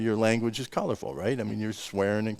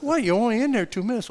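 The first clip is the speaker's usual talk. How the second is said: higher-pitched mock disbelief; imitated incredulous dad; 12 semitones higher than usual. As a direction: question it in surprise, pitch jumping up. Retold father's surprise at son's scolding.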